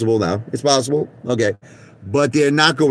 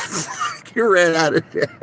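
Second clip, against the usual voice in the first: laughing; 10 semitones higher, slower than usual. Real, not acted. Laughing after retold scream.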